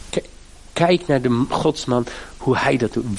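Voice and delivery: usual speaking voice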